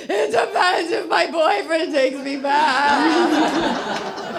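slow, laughing half-sob of a line; laughing while saying it. Retold or imitated. Telling the customs agent her plan, laughing. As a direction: let it tumble out through laughter. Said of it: "And I said".

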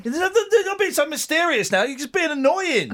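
hammed-up scold of the nagging woman; mock telling-off. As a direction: bicker at her like a cross husband.

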